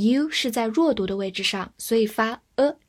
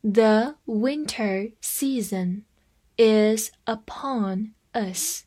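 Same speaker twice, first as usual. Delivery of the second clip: slow, separated words for learners to follow; slow read-along; much slower than usual. Shown as a demonstration. Teacher has students follow along, slow word-by-word reading. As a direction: read slowly word by word, pausing between words. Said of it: "好，跟着我慢读一遍".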